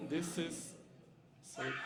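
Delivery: ordinary talk, nothing marked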